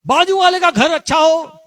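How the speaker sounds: called out high and loud for the neighbour to hear; a showy blessing shouted over the wall; loud taunting blessing. Retold or imitated.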